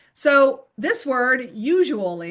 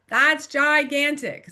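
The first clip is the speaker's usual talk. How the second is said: normal talking voice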